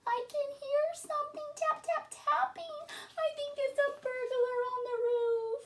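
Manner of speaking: high squeaky little mouse voice calling out. Acted. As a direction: call for help like a tiny scared mouse.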